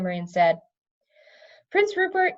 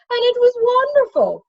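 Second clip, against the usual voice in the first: very high, loud burst of delight; light up with joy; 8 dB louder than usual. A narrator's reading. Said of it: "face lit up".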